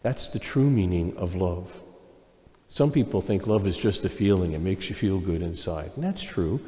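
ordinary talk

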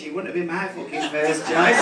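usual speaking voice

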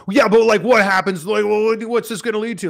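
loud, high impatient-viewer complaint; hammed-up agitated questioning; mock fretting. Acting out an impatient viewer's reaction.

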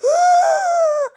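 high, loud, drawn-out shriek; shocked scream. Acted. Sister seeing what happened now, shrieking scream.

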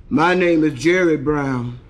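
heavy voice. Acted. Third triplet answering the teacher, heavy voice. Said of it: "How come you talk so heavy".

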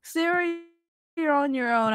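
ordinary delivery

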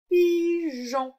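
high, loud, stretched syllables; sounded out. Shown as a demonstration. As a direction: hold each syllable for the pupil. Teacher sounding out the answer word. (mot découpé, aigu et appuyé)

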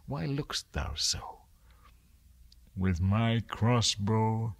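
plain reading voice

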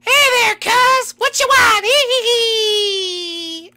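very high, loud, manic squeal; ecstatic Lemmy. Acted. Lemmy greets his cousin in a high manic squeal. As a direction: bounce off the walls with giddy glee. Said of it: "a little more ecstatic than I remember".

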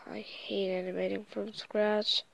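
whispered grumble; breathy whispered complaint to self; whispering. Real, not acted. Muttered complaint while working.

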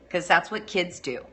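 ordinary talk

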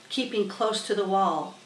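ordinary delivery

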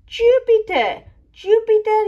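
high, loud cry of the name; child explorer shouting the planet name; excited announcement. Acted. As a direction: call it out in awe.

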